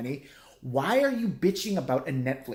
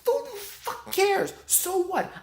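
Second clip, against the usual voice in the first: much higher, slow incredulous yell; exasperated outburst; much slower than usual. Real, not acted. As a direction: throw it up high in disbelief. Ranting at complainers.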